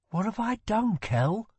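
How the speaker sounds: high, pleading despair; stricken, remorseful question; dismayed. A narrator's reading. Prince despairs to his brother.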